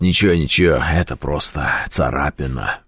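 low, quiet, slowed mock stoic voice; brave understatement. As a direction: lightly play a wounded man brushing it off. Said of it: "кто, э, говорит". (тихо и низко, как стойкий раненый)

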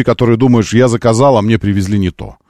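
ordinary talk

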